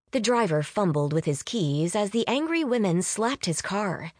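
usual speaking voice

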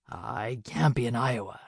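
a hurt stranger barely able to speak; weak injured man. Acted. Hurt stranger to Nora, low and dazed.

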